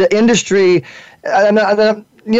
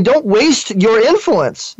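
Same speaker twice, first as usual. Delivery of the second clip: ordinary talk, nothing marked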